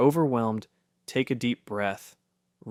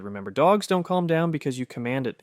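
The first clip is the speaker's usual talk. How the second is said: usual speaking voice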